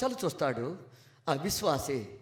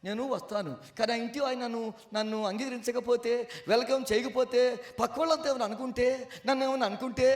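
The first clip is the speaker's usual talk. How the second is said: ordinary delivery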